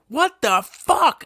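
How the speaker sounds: slow, high mock-indignant reaction; mock shock. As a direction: stretch it out in stunned outrage. Acting out Emma Stone's shocked ad-lib.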